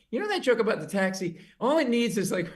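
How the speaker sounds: lightly voiced bar hooker; hooker giving joke notes. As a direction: play the barfly critic helpfully. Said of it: "she'd go".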